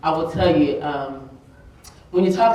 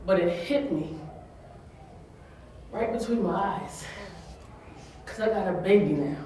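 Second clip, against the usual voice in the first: tearful; 6 dB quieter than usual. Real, not acted. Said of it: "I'm gonna cry".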